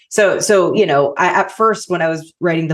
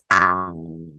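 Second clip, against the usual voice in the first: low, slow creaky fry; vocal fry; 10 semitones lower, 4 dB quieter than usual. Shown as a demonstration. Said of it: "it's all that stuff".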